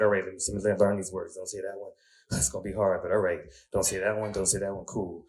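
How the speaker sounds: soft mumbled memorizing; quiet self-talk drilling the banned words; muttering to himself. Retold or imitated. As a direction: murmur the list under your breath.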